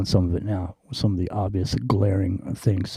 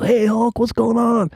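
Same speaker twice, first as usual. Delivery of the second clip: excited greeting; 13 semitones higher, 3 dB louder than usual. Retold or imitated. Fan greeting Hulk excitedly.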